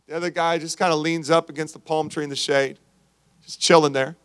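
ordinary talk, nothing marked

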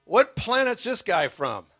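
put-on retelling his young self's incredulous scoff. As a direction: scoff at the sweet pastor in disbelief. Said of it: "And I'm going".

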